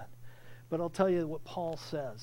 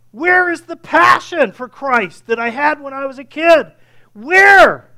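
much louder and higher, shouting the challenge; full-force preaching; 8 semitones higher, 18 dB louder than usual. Real, not acted. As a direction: thunder it from the pulpit. Preaching climax, self-rebuke.

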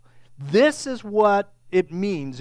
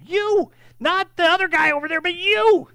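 high, forceful stress on YOU; emphatic YOU; 9 semitones higher, 5 dB louder than usual. Shown as a demonstration. Preacher singling out each listener, emphatic high-pitched YOU. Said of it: "it is an emphatic".

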